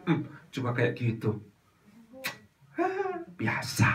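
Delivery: soft, dragged-out scoff; dismissing someone's suffering, soft slow scoff; callous shrug. Acted. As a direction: shrug it off coldly. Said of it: "lihat orang menderita".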